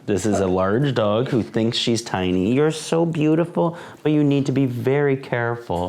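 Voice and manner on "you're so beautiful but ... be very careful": high, cooing voice for the dog; sweet dog talk. Acted. Cooing at the big dog who thinks she's tiny, high and sweet. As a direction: coo it gently to the pup.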